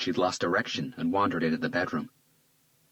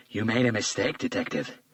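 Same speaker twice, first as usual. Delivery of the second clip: cold taunt; 4 semitones higher than usual. A narrator's reading. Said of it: "cold smile".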